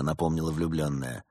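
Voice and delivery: ordinary delivery, nothing marked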